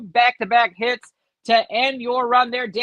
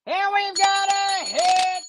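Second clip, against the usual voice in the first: drawn-out, high excited announcement; 8 semitones higher, much slower than usual. Real, not acted. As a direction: announce the hit with delight. A hit card appears.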